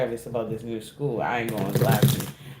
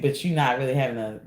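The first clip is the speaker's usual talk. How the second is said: usual speaking voice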